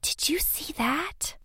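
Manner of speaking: slow breathy frightened whisper. Acted. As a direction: whisper it, scared. Seeing a ghostly woman.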